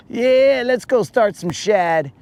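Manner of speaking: lightly voiced friend, teasing him; imitating Moon. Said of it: "he'll be like".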